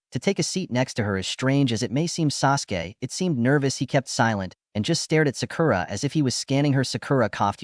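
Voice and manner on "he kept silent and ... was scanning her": usual speaking voice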